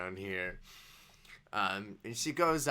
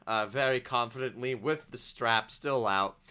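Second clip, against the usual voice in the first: ordinary delivery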